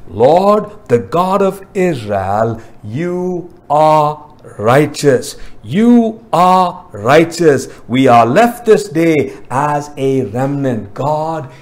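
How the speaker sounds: slow, weighty prayer with spaced-out words; reverent proclamation. Acted. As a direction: address God solemnly, drawing out each attribute. Voices Ezra's prayer of praise.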